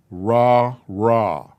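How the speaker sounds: "Ra, ra." as two separate open syllables; sounding out syllables. Shown as a demonstration. Teacher models two Hebrew syllables for students, carefully. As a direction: say "Ra, ra." cleanly, one beat each. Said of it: "how would I pronounce".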